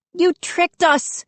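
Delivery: put-on very high, outraged girl; high-pitched indignant scream; screamed accusation. A narrator's reading. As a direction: accuse them in a high cry. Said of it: "Mindy screamed at the two gnomes".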